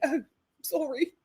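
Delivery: imitating a tearful girl, slowing down; hammed-up sob story; mock crying. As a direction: put on a weepy apology. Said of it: "then the girl started crying".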